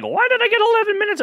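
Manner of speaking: squeaky high, fast bewildered question; mock confused listener. Host imitates a listener wondering why the show paused. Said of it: "you're like".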